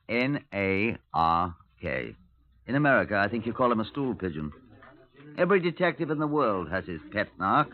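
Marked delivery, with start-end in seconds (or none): 0.1-2.1 N A R K spelled slowly, letter by letter. Shown as a demonstration. Detective explaining slang to American listeners, spelling it out slowly. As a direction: spell N A R K, each letter slowly set apart. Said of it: "I expect you don't know what a narc is".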